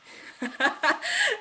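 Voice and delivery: high, sudden laugh; burst of laughter. Real, not acted. Vlogger breaking up after her sung ending, high laugh. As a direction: crack up after being solemn.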